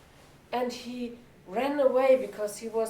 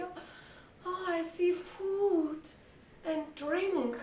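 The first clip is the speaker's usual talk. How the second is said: slow, raised, hushed wonder; awed donkey; 6 semitones higher, 4 dB quieter, slower than usual. Acted. Donkey peeks through the window at food, slow wonder. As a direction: marvel slowly at what you see.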